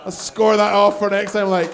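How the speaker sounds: normal talking voice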